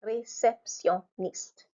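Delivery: réceptionniste slowly as ré-cep-tion-niste; slow French réceptionniste, each syllable separate. Shown as a demonstration. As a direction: sound out réceptionniste with small breaks. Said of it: "you stop, huh?".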